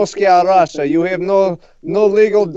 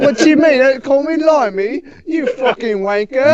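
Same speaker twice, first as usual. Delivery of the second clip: mock British outrage; 6 semitones higher than usual. Brit retorts angrily.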